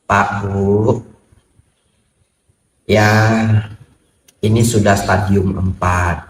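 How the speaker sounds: slow, halting delivery of bad news; grave doctor. Doctor telling patient of terminal illness, grave and slow. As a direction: break it gently with long pauses.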